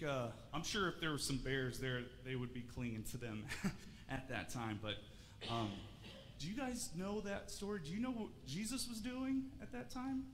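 ordinary talk, nothing marked

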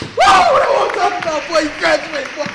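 very loud, high proud yell; booming celebratory holler; hollering cheer. Acted. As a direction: shout it out from the audience. Relative cheering graduate's name.

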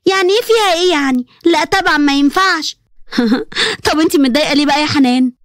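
usual speaking voice

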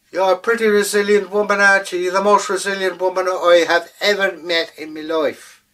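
high, loud, buttering up; flattering leprechaun. Acted. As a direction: lay the flattery on thick.

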